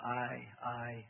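hesitant repeated word; slight stutter. A narrator's reading. Demon cornered by Bob, stuttering a little. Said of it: "began to stutter a little".

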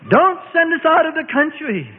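high, urgent plea; insistent high-pitched begging of cornered spirits. A narrator's reading. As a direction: beg desperately, pitched well up. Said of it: "they begged".

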